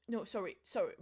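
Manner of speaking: usual speaking voice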